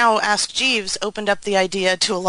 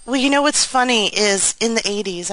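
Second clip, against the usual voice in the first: ordinary talk, nothing marked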